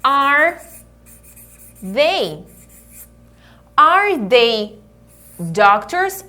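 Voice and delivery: slow teacher demo, pausing between words; "Are... they... doctors?" in separated pieces; word-by-word model. Shown as a demonstration. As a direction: build the question word by word. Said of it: "Então eu falo".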